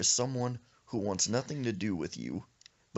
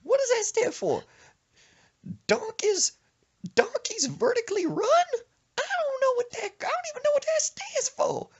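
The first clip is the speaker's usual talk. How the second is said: wailing grandma; 5 dB louder than usual. Retold or imitated. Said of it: "She said".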